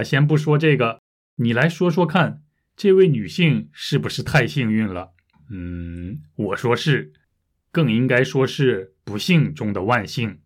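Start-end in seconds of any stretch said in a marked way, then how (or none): none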